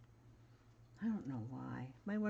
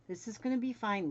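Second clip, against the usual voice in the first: higher, louder sing-song "fine"; upbeat self-reassurance; 7 semitones higher, 5 dB louder, faster than usual. Real, not acted. Sewing vlogger reassuring herself, higher and louder. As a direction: cheer yourself on brightly.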